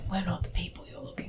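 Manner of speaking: soft whispered threat; subtly menacing. Acted. Player suggesting how to scare the guard captain, subtly menacing. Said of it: "in a subtle sort of menacing way".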